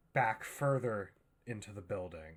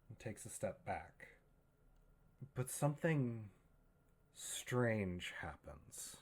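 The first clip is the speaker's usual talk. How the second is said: ordinary talk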